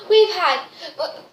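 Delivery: ordinary talk, nothing marked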